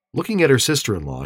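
plain reading voice

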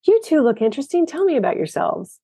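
lightly imitated British accent, starting high and quick. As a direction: retell it with a hint of British accent. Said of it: "with a British accent".

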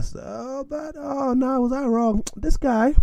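high, soft falsetto aside; high-pitched comic regret; mock lament. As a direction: pitch it way up, rueful and quiet. Storyteller mock-lamenting his mistake.

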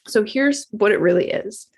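normal talking voice

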